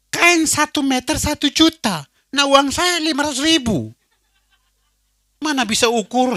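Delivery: alarmed protest. Retold or imitated. Groom panics at the tailor's price, loud and high.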